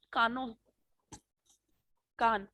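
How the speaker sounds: ordinary delivery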